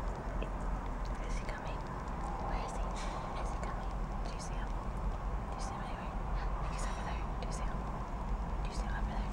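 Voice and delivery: hushed whisper, barely voiced. Real, not acted. Whispering to her partner while hiding from someone. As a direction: whisper so he won't hear you.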